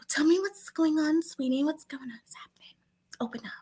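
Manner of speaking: high, soft mock therapist, fading to a whisper; put-on soothing voice trailing into whispers; imitating coaxing therapist. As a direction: coo it sweetly, sinking into a whisper. Voicing therapist coaxing with sweetie.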